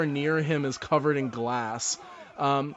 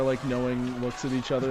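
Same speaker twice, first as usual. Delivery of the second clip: ordinary talk, nothing marked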